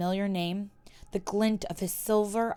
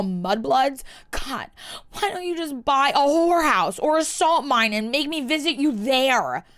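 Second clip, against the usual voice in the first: angry rant; 5 semitones higher, 5 dB louder than usual. Acted. Drunk Hermione ranting at Malfoy.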